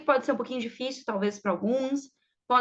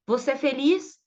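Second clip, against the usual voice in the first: "Você é feliz?" rising on FELIZ; question intonation; 3 semitones higher than usual. Shown as a demonstration. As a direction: lift the end of "feliz" to ask. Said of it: "só percebe pela entonação". (entonação de pergunta, subindo em "feliz")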